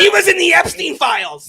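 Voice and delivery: loud, high, slow pile-on; emphatic call-out. Real, not acted. Debater piling on accusations about Trump, loud and slow. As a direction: belt it out slowly over the others.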